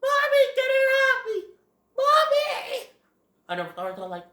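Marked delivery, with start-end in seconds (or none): 0.0-2.9 shrieking very high and loud for his mom; panicked screaming. Retold or imitated. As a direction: scream in terror as the turtle bites. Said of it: "he screams".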